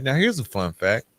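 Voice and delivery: ordinary talk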